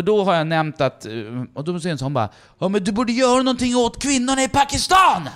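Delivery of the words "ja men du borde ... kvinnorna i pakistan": put-on high, loud drunken heckler's yell; screaming heckler. Drunk woman heckles the comedian from nowhere, screaming. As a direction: yell it out from the crowd, pitched way up. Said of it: "skriker de bara".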